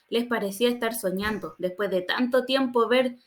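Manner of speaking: ordinary delivery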